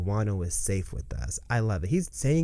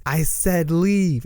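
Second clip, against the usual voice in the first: higher, louder and slow, every word weighed; stern command; 6 semitones higher, 5 dB louder, much slower than usual. Retold or imitated. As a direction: order him out, drawing each word out. Boy orders the admiral away.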